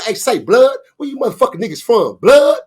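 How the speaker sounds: hammed-up gang challenge, rising on blood; gangbanger challenge. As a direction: pop off like a street gang member claiming turf. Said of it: "I spent right back around in character".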